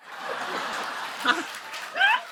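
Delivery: breathless high-pitched laugh with no voice; airy high laugh. Real, not acted. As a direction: break up laughing silently. Laughs after the failed chant.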